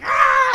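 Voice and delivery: loud, high charging scream; acted-out wild shriek; mock attack scream. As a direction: scream while giving chase. Chasing woman's scream.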